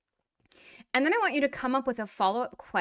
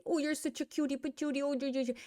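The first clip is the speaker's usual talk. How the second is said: put-on higher, cooing baby talk; 4 semitones higher, 4 dB quieter, faster than usual. Adult admiring a little Russian kid, cooing baby talk. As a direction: coo as if at a chubby-cheeked toddler. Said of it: "look at a little Russian kid and say".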